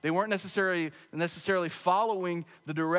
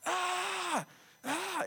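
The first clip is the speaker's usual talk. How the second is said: much higher, softer drawn-out imitated shriek; toddler dinosaur screech; 13 semitones higher, 5 dB quieter than usual. Pastor imitating his son's dinosaur screech in Denny's, high mock shriek. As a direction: squeal it like a toddler playing dinosaur. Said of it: "Micah was doing the dinosaur".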